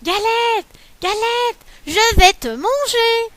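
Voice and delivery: high, loud hungry rabbit, slow on the threat; a shrieking little rabbit bent on eating; rabbit cries. Acted. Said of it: "crie le lapin".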